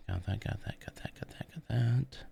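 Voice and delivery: quick, barely voiced self-talk; whispering to self. Real, not acted. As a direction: whisper it under your breath. Muttering to self while building.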